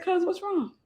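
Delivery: high, soft nonchalant question; calm. Retold or imitated. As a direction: ask it totally unbothered. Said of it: "Just as calm".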